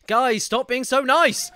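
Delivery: high, loud, drawn-out plea; mock-scolding shout. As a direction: cry out in mock protest at their generosity. Streamer mock-scolds generous donating viewers.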